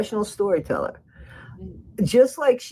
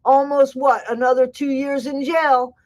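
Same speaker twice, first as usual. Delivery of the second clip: higher-pitched imitation of a Bayou accent as Ken; 5 semitones higher than usual. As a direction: put on a playful Bayou accent to mimic him. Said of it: "Do I do that Bayou accent good?".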